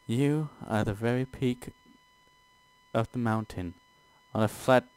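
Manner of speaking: lightly seductive narration; soft demon voice. Acted. Reading in character voice.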